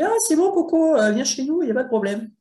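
hammed-up salesman welcoming a sucker; imitating a slick publisher promising everything; chummy publisher. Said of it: "qui vous répond et qui vous dit".

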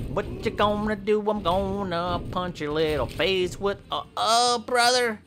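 put-on high whiny kid-brother voice; high bratty sibling complaining. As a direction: whine about your hurt back.

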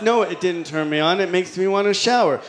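usual speaking voice

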